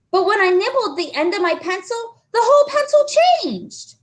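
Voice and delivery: high, earnest schoolboy voice; anxious boy explaining. Acted. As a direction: try hard to make her believe. John explaining.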